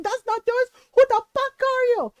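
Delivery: high, loud retold yell; angry swearing. Mom swearing at the purse snatcher, yelling. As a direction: imitate a furious mom cursing out a stranger. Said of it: "she's swearing at him".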